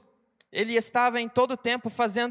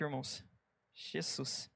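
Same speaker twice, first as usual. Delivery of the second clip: words spoken around a sip of water; while sipping a drink; 8 semitones lower, 17 dB quieter than usual. Real, not acted. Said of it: "Deixa eu tomar um pouco de água aqui".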